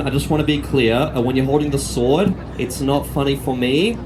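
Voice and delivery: normal talking voice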